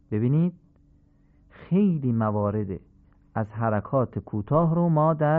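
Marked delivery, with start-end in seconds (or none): none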